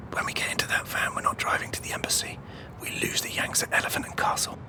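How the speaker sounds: fast, hushed conspiratorial whisper. Real, not acted. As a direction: whisper the escape plan quickly. Whispering secret plan.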